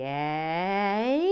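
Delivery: very loud, drawn-out Canadian "A" sliding upward; bad Canadian accent. Shown as a demonstration. Host showing how wrong the Canadian A goes, bad Canadian accent. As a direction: stretch out a badly faked Canadian "A". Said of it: "I get it very badly wrong".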